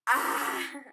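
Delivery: sharp loud scream of pain. Retold or imitated. Student reliving the finger injury in gym, sharp loud scream. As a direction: yelp like your finger just bent back. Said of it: "And I screamed".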